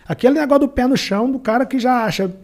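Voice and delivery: high, loud mock mother warning; a hint of a nagging mother. Voicing his mother's don't-get-excited warning, high and loud. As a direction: wag a finger like a cautious mum. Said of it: "como sua mãe".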